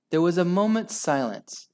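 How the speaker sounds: plain reading voice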